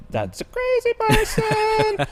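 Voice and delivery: very high, slow squeak; hammed-up high-pitched aside; mock squeak. Mock passerby reaction.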